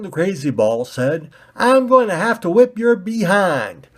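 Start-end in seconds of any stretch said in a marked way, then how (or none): 1.5-3.9 high, loud bumpkin threat. Acted. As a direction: warn them you'll fight. Pointy ball threatens.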